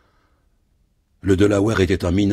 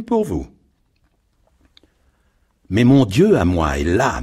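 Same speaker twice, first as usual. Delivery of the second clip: a lightly voiced sly villain, higher; scheming shipowner; 7 semitones higher than usual. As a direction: gloat slyly like a crooked boss. Gilchrist boasts of money god.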